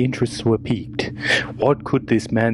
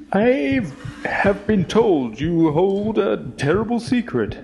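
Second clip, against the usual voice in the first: cautious address; 10 semitones higher than usual. Acted. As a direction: speak warily to the creature. Addressing the cave creature.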